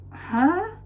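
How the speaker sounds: high, sharply rising questioning sound; baffled disbelief. Acted. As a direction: pitch it way up in incredulity. Baffled by the reskin.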